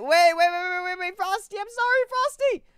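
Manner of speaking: high, loud flustered rush of waits; sudden apology. Real, not acted. Calling out to trade partner Frosty he forgot, flustered high apology. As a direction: catch him before he leaves, apologizing.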